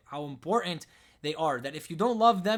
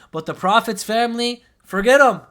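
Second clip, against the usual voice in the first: loud, higher, scornful jab; bitter sarcasm; 6 semitones higher, 12 dB louder than usual. Real, not acted. Speaker mocking how listeners ignore the Prophet's family, scornful loud jab. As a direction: spit out the dismissal with contempt.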